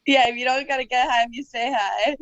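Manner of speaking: giggling through the words; giggly. Real, not acted. Guest repeating her own tip to the hosts, giggly. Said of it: "she was pretty giggly".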